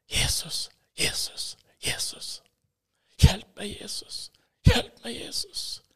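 gasped, voiceless whisper; desperate whisper. Retold or imitated. Terrified man calls on Jesus for help, desperate whisper. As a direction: whisper a desperate plea between heavy breaths. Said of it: "jag bara viskar".

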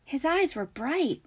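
lightly voiced dreamy girl. A narrator's reading. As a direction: lift it high and draw it out. Voices Luna in story.

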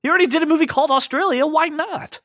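very high, breezy self-quote; open-minded musing. Retold or imitated. Host recalling his reaction to casting rumors, high-pitched musing. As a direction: replay your own 'why not' in a high voice. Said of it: "I was like".

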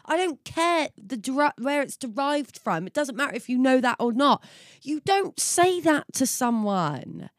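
high, heated scolding; exasperated rant. Real, not acted. Friend scolding Freya for the joke, high exasperated rant. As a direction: lecture her, worked up.

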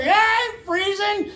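high, drawn-out mock shout; yelled back. Runner yelling back at the cop, mock defiant shout. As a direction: holler it slowly over your shoulder. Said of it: "I yelled back over my shoulder".

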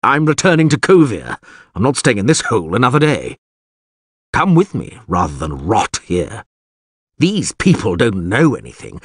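indignant. A narrator's reading. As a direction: vent disgust, then press for an answer. Wizard angrily announces he leaves.

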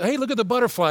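a light, quick distracted chirp; distracted ADD voice. Preacher mimicking his own distracted self. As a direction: suddenly drift off mid-thought. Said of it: "sometime I'll be talking like this".

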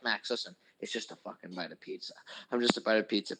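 low, soft inner voice; cooling himself down. Retold or imitated. Retelling his attempt to calm down, soft self-soothing. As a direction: talk yourself down quietly, dropping low. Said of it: "trying to cool myself down".

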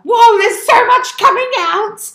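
high, loud, slow amazed whoa; astonished whoa. Retold or imitated. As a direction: gasp it out in wonder. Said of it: "I was like".

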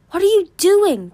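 high, loud, urgent challenge; alarmed question. A narrator's reading. Darkstalker as Moon runs toward the cave, alarmed. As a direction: call after her in alarm.

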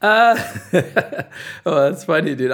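laughing. Real, not acted. Laughs at reunion question.

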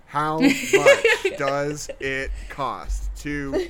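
spelled out slowly to Google; over-enunciate like to a slow listener; slow and clear. Shown as a demonstration. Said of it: "spell this out real clear for you".

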